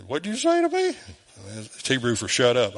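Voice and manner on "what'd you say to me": much higher-pitched mock indignant question; imitated startled wife. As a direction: mimic a taken-aback wife, pitched way up. Retells wife's startled question.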